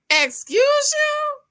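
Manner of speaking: high, slow, indignant excuse you; sassy excuse you. Acted. Vlogger talking back to the cast member, indignant. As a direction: drag it out, offended and high.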